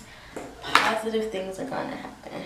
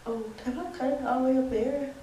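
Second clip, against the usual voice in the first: ordinary delivery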